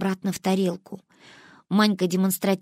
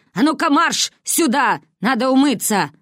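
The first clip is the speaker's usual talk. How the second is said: commanding, a bit higher and louder; bossy grandmother; 6 semitones higher, 4 dB louder than usual. A narrator's reading. Baba Roza commanding the girls to wash. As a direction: order them to the sink.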